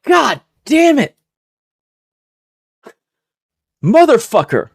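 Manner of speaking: high, drawn-out dismay; exasperated groan. Retold or imitated. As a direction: let it sag out in dismay. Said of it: "I was like".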